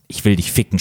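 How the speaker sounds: usual speaking voice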